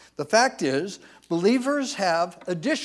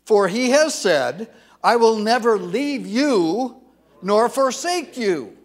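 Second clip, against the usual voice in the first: louder, slow, emphatic; loud scripture reading; 5 semitones higher, 9 dB louder than usual. Shown as a demonstration. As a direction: read it out with force. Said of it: "Let's continue reading".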